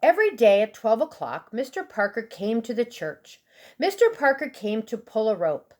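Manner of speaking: ordinary delivery, nothing marked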